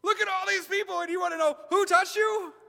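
very high, loud, cartoonish disbelief; incredulous disciples. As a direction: squeal it like they think he's lost it. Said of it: "The disciples are like".

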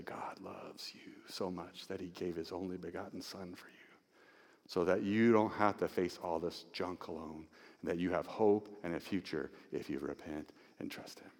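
very soft, low, near whisper; hushed, tender reassurance. Acted. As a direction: tell one hurting person softly, slowing at the end. Intimate earnest assurance to each listener.

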